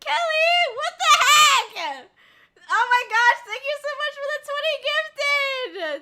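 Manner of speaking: shrieking high and very loud, laughing and half crying; screaming in shock. Real, not acted. Streamer shrieking at Kelly for twenty gifted subs, shocked excited scream. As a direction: scream her name in stunned disbelief.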